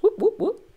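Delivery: light, very high falsetto whoops; imitated whooping noises. Host showing the noises a swordsman makes, playful high whooping. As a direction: toss out three little high whoops. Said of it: "he just makes noises. He goes".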